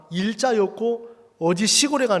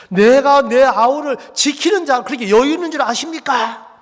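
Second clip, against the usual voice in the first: loud, higher-pitched defiant retort; brazen shouting back; 5 semitones higher, 6 dB louder than usual. Acted. Cain talks back to God about his brother, loud and defiant. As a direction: act out a bold, indignant comeback at full volume. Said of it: "가인도 큰 소리 빵빵 치는 거예요".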